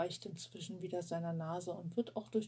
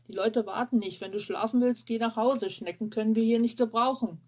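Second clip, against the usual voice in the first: loud, scolding chef; furious chef; 2 semitones higher, 8 dB louder than usual. Acted. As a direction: bark the rebuke across the kitchen. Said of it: "in Küchen konsequent nur gebrüllt".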